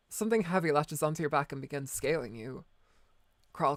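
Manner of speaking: ordinary talk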